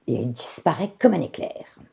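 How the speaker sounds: ordinary delivery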